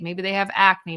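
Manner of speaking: ordinary talk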